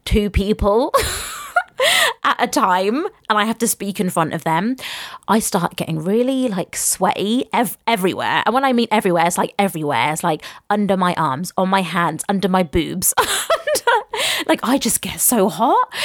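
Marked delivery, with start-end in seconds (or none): 1.0-2.2 high embarrassed giggle. Real, not acted. Embarrassed about public speaking. 13.2-14.4 high embarrassed giggle. Real, not acted. Sweating under my boobs.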